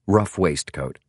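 ordinary delivery

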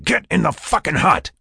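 forceful high-pitched command; urgent order. A narrator's reading. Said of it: "he ordered".

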